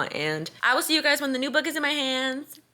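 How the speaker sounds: ordinary talk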